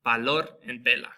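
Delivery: "valor, vela" with the V said as a B, "balor, bela". Shown as a demonstration. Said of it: "just like the B in boy".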